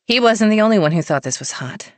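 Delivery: ordinary delivery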